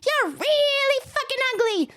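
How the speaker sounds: high-pitched joke-parrot insult. Acted. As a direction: heckle like a rude parrot. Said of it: "The parrot says".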